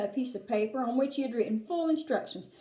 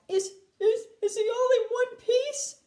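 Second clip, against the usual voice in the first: high, anxious mother's cry; anxious yell; 10 semitones higher, 4 dB louder than usual. A narrator's reading. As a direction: call out in high-pitched worry. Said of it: "yelled Missus T V".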